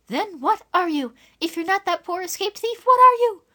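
put-on high, fierce girl demanding, loud at the end. Dora fiercely confronting the stranger, high and loud. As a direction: demand the truth fiercely, rising to a loud cry. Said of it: "asked Dora fiercely".

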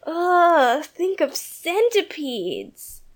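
put-on child's horrified shudder; shuddering disgust. A narrator's reading. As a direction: squeal of disgust, dragging the words. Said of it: "said Jane with a shudder".

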